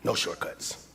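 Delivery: breathy whisper of the key line; hushed whisper for the notes. Shown as a demonstration. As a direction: lean in and whisper it like a secret. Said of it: "Put this in your notes".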